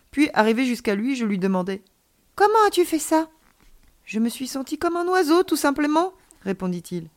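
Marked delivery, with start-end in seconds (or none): 2.4-3.3 put-on high, loud, astonished child; amazed question. A narrator's reading. Child asks alien about the flight, amazed. 4.1-6.1 put-on high childlike voice; blithe child. A narrator's reading. Alien child explains flying to friend, blithe.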